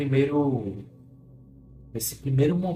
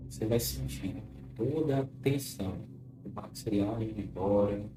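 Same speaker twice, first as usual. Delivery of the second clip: guided hypnosis; 2 semitones lower, 9 dB quieter than usual. Acted. Leads a clinical hypnosis session.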